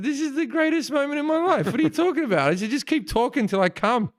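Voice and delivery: high-pitched, thrilled exclamation; hammed-up starstruck delight; gushing reply. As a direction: gush back at your hero, pitched high. Acts out his delighted reply.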